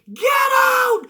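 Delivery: high, piercing shriek of a yell; screaming get out. Retold or imitated. Lana screaming at Aiden in the ring, a shrill furious yell. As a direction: scream it way up high at the top of the lungs. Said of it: "Screaming at the".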